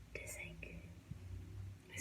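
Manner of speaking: barely voiced, slow whisper; whispered dread. Stranded driver whispering to herself, slow whisper. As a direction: breathe it out as the bad news sinks in. Stranded car won't restart.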